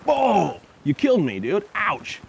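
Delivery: lightly hammed pain for the dog's sake; hammed-up hurt decoy. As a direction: overact being bitten badly. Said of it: "I go".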